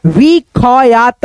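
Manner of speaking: विखयात slowly as vi-kha-yaat; full vowel after ख in विखयात; split-letter misreading. Shown as a demonstration. Teacher showing what not to read, विखयात pulled apart. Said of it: "विखयात नहीं पढ़िएगा".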